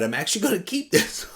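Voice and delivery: high, quick words tipping into laughter; gleeful, breaking into a laugh. Real, not acted. Collector refusing to give the book away, laughing.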